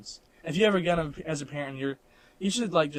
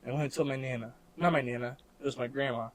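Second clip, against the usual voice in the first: normal talking voice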